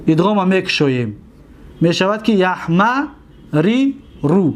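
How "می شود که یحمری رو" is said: each syllable drawn out for students; teacher's slow model reading; slow demonstration. Shown as a demonstration. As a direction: spell the form out slowly. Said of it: "دیدید؟".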